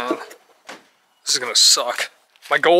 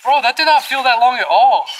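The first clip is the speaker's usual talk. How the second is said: surprised; 7 semitones higher, 6 dB louder than usual. Real, not acted. Surprised timer already done.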